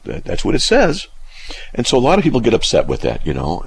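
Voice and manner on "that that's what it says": higher, halting retold discovery; retold realization. Recalling studying the Scripture, retold realization. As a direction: re-enact a hesitant dawning realization. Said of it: "I went".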